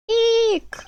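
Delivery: high, loud squeal of fright; a sudden little shriek; startled eek. Acted. Startled figure squeals at the voice, high and loud.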